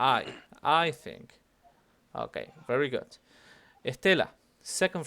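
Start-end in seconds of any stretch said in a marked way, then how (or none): 0.0-1.4 I loud, think dropped away; stress on I. Shown as a demonstration. Teacher marking out your opinion, stress on I. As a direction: punch I, let think fall. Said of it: "marking out that it's your opinion".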